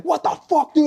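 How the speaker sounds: high, outraged mock yell; indignant outburst. Marine who failed inspection, high indignant outburst. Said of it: "He's like".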